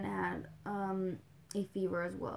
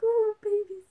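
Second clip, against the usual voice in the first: excited squeal; 12 semitones higher, 9 dB louder than usual. Retold or imitated. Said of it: "I'm like".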